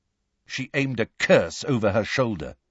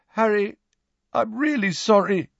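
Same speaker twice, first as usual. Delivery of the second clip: put-on timid boy voice; 9 semitones higher than usual. A narrator's reading. Neville apologizing to Harry.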